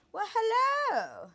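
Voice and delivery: high, slow, delighted hello; excited greeting. Real, not acted. Greeting a surprise in the room.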